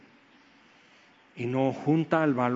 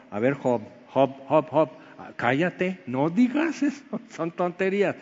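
playful scolding; 2 semitones higher than usual. Acted. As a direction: tease Job, tell him to hush. Preacher scolds Job playfully. (regaño juguetón a Job)

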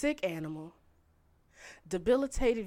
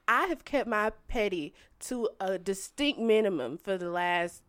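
ordinary delivery

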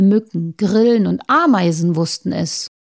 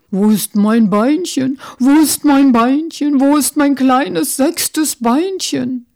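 put-on old beetle wailing louder and higher; frantic cry, slower on the last plea; crying out for his leg; 4 semitones higher, 4 dB louder than usual. Great-grandfather beetle cries out for his lost leg, loud and higher. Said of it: "schrie und sagte".

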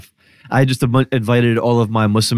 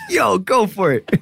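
delighted cheer; 8 semitones higher than usual. Retold or imitated. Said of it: "And I was like".

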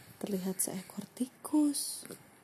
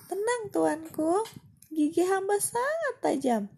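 mouse; 10 semitones higher, 5 dB louder than usual. Acted. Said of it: "jawab tikus".

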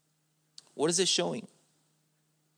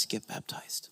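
whispered question; 14 dB quieter than usual. Acted. Preacher repeats question in whisper.

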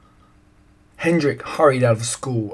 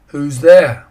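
calling out; 3 semitones higher, 11 dB louder than usual. A narrator's reading. Man calling down from dike.

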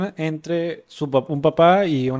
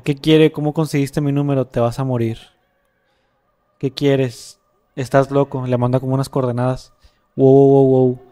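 louder, building to a loud wow; dramatic story reading; 9 dB louder than usual. Acted. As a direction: read the scary messages with rising alarm. Reading chat story aloud.

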